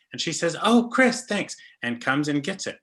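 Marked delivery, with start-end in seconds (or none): 0.6-1.6 much higher mock-delighted thanks; imitating grateful friend. Friend thanks trapper for a Snickers, imitated high and pleased. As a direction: pitch up to play a thankful friend. Acts out Michelle thanking him.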